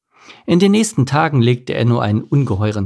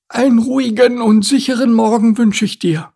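high-voiced turtle character; turtle's greeting; 12 semitones higher, 4 dB louder than usual. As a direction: wish a safe morning in a high, careful turtle voice.

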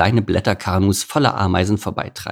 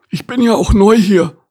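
loud, high-pitched grumble; a sullen animal character, pitched up; grumpy grumbler; 13 semitones higher, 8 dB louder than usual. Acted. Newcomer answers the beaver, grumpy. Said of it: "brummte der Spitznasige".

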